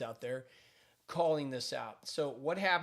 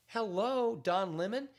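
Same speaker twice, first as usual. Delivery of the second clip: high, louder mocking hello; sarcastic hello; 6 semitones higher, 3 dB louder, slower than usual. Real, not acted. Commentator mocking Don Lemon's claim, sarcastic high hello. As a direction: call him out with a sarcastic hello.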